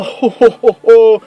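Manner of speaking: put-on booming jolly Santa laughing for the kids. Shown as a demonstration. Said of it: "the laugh I do for Santa Claus".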